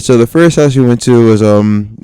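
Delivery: ordinary talk, nothing marked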